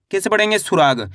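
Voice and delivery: usual speaking voice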